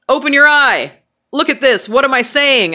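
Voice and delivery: loud, high-pitched urgent orders; imitating a neurologist's insistent shout. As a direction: call out to a sedated patient, high and loud. Neurologist barking orders to sedated patient.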